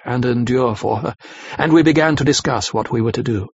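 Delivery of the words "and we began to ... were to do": ordinary delivery, nothing marked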